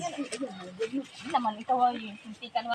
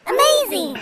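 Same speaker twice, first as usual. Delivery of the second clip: excited; 9 semitones higher, 5 dB louder, slower than usual. Acted. Kids find ripe fruit.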